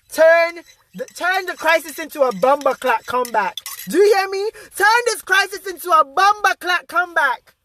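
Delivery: rousing Jamaican patois call to turn crisis into comeback; Jamaican accent. Pretend. Vlogger rallying viewers in Jamaican accent, fired up. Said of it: "Y'all getting the full Jamaica today".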